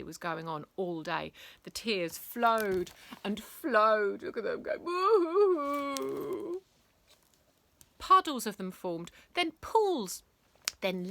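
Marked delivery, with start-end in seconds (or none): 4.8-6.6 high, loud, slow woo-hoo-hoo boohoo; slow, high mock blubbing; hammed-up sobbing. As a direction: wail like a cartoon crybaby. Family crying floods of tears.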